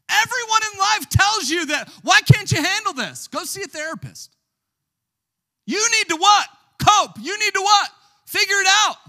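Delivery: high, loud fiery preaching; shouting the world's advice; preaching at full force. Acted. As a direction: drive it home like a revival preacher.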